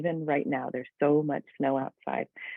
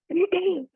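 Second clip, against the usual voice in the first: throat clearing. Real, not acted. Coughing mid-talk.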